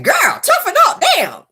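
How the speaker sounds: high, loud exasperated scolding; fed-up, high-pitched outburst. Acted. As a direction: tell off a crier, pitch jumping up. Scolding the crier, acted.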